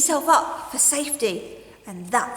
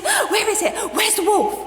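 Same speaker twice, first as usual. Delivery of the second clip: high, loud urgent question; anxious villagers; 11 semitones higher, 5 dB louder than usual. Acted. Villagers arriving breathless, asking urgently. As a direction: ask in alarm.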